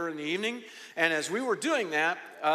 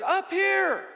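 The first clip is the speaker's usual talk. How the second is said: high holler from a distance; calling out; 11 semitones higher, 4 dB louder than usual. Retold or imitated. Family on top calling down the hill, imitated high holler. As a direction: call down to someone far below.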